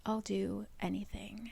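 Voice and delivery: soft unvoiced plea; pleading whisper. Feyre begging Tamlin, whispered. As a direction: beg in a whisper. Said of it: "looks at him and says".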